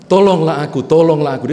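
very loud, high cry for help; desperate shout. Acted. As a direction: scream for help with everything left. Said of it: "Dia teriak begitu". (teriakan keras minta tolong)

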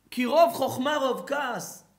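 loud, high proclaimed verse; preaching quote. Acted. Rabbi proclaiming Solomon's verse to the class, loud. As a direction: call out Solomon's words.

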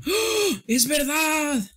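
surprised realization. Real, not acted. Streamer noticing the drawing has no crying, high surprised shout. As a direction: yelp it as it suddenly dawns on you.

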